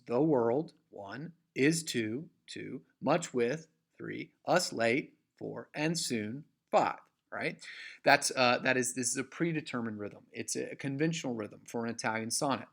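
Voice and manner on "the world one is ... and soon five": slow scansion with stress on world, too, with, late, soon; each two-word foot rising to its second word, then counted; counting iambic feet. Shown as a demonstration. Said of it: "five feet of disyllabic iambs".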